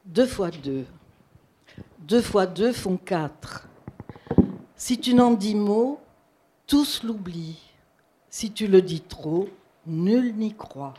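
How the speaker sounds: measured poem recital with long pauses between lines; poetry reading. Acted. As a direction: recite it line by line, letting each one settle. Reads a translated poem aloud. (lecture de poème posée, vers par vers)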